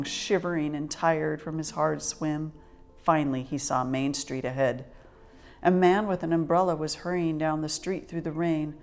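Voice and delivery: ordinary delivery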